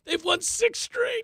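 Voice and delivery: high, drawn-out exclamation; excited. Real, not acted. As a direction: crow about the streak, pitch soaring. Excited about Rockies' winning streak.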